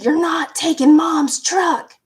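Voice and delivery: slow, loud shouted threat; brother yelling. Retold or imitated. Brother chasing her outside, yelling a loud slow threat. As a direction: bark it at her like an order. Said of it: "my brother chased me outside".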